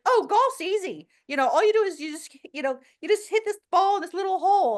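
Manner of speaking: high, mocking voice of someone who thinks golf is easy; mocking golf skeptic. Mocking people who call golf easy, high mock voice. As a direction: sneer it like a know-it-all who never played. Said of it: "it's like".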